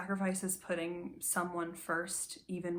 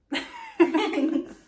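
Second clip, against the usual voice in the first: bright laugh; 10 semitones higher, 11 dB louder than usual. Real, not acted. Laughs after memory.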